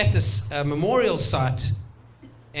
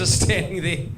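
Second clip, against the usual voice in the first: ordinary talk, nothing marked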